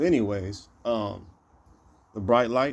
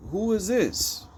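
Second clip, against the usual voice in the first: high-pitched mock demand, little louder; retold calling out; 10 semitones higher than usual. Brother calls to strangers in a car, mildly called out. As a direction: retell a challenge called out, pitch jumping up. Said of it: "out loud".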